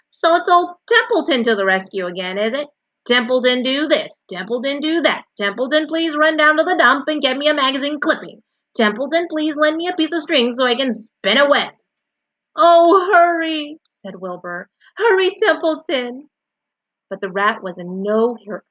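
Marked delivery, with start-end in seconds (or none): 0.1-11.7 loud, high, mocking recital of demands; disgusted complaint. A narrator's reading. Templeton resents coming to the rescue, disgusted. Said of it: "he said in disgust". 12.6-13.8 high, loud wail; urgent plea. A narrator's reading. Wilbur urges Templeton to climb, pleading loudly. 15.0-16.3 high, loud, insistent; urgent plea. A narrator's reading. Wilbur rushes the unhurried rat, urgently pleading.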